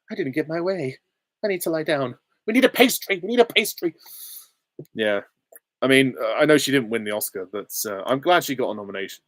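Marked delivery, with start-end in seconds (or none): 0.1-3.9 high-pitched, pouting woman's voice; Glinda. Acted. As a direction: whine it higher and higher. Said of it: "And she's like".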